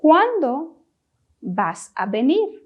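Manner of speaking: "¿Cuándo" set apart, pause, then "vas a venir" rising; repeat-after-me phrasing. Shown as a demonstration. Teacher asks learners to repeat in Spanish, in separated parts. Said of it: "Please repeat the question in Spanish".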